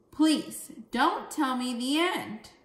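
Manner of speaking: loud, slow, exclaiming plea; exclaimed. Shown as a demonstration. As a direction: beg loudly, like a scream for kids. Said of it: "Here you see an exclamation point. Screamed Steve".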